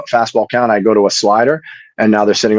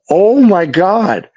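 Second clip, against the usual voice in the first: slow, higher "oh my God" in mock shock; slow, higher, retold astonishment; stunned disbelief; 9 semitones higher, much slower than usual. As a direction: drag it out like you can't believe your ears. Retelling his own shocked reaction.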